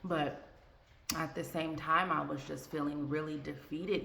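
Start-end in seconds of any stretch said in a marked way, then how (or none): none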